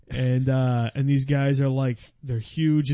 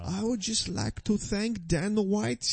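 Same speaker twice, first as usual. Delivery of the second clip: put-on high-pitched Brazilian fighter's accent; mock Brazilian accent; 6 semitones higher than usual. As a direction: imitate a Brazilian fighter politely thanking people in broken English. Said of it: "and they talk like this".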